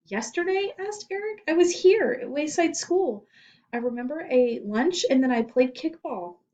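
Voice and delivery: put-on a small boy answering the principal; frightened boy.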